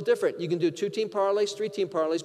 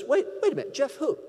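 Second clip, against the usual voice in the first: higher-pitched, retold wariness; suspicious questioning; 7 semitones higher than usual. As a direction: challenge the stranger as a doubting caller. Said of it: "He's gonna question my credentials".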